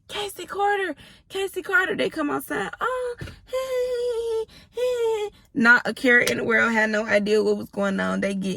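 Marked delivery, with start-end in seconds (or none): 0.1-1.9 high sing-song call, much higher; calling the kids. Retold or imitated. Mother calling her kids outside, high calling voice. 2.8-5.3 put-on very high, light little-kid voice; cheery kid greeting. Kids coming outside greeting mom, cheery child voice.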